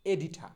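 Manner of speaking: এডিঠা with aspirated ঠ and open আ, no r; corrected pronunciation. Shown as a demonstration. Teacher drilling editor with learners, এডিঠা with aspirated "tha". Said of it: "সম্পাদকের ইংরেজি হচ্ছে".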